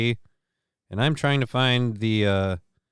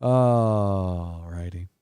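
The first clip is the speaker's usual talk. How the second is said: slow drawn-out; 6 semitones lower, much slower than usual. Real, not acted.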